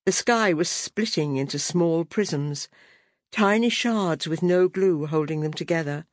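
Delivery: ordinary delivery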